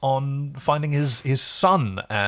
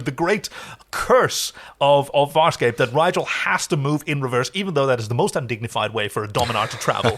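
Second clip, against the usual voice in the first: usual speaking voice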